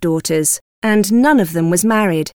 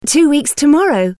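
higher, happy daughter's reply; 6 semitones higher than usual. A narrator's reading. Daughter telling father about the ball, happy. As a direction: answer brightly with pleasure. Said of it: "replied Elizabeth happily".

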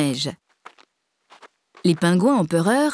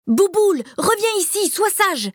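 put-on boy calling his dog back; 11 semitones higher than usual. A narrator's reading. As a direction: shout it after the running dog. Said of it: "crie Alain".

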